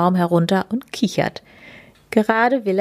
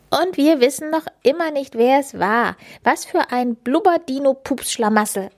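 higher cartoon creature voice; 6 semitones higher than usual. A narrator's reading. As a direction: play the grumbling little creature, pitched up. Schnüffelo character voice.

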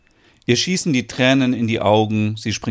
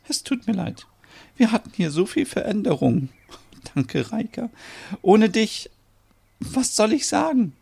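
tearful apology; 11 semitones higher than usual. Acted. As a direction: apologise while overcome with feeling. Tearful Merit apologises to Reika.

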